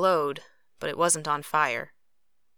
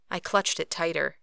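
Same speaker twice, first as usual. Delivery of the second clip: plain reading voice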